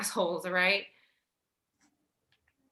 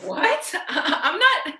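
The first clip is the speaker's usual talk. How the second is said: laughing disbelief; 6 semitones higher, much slower than usual. Acted. Laughing off his brother's accusation.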